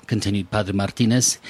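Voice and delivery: plain reading voice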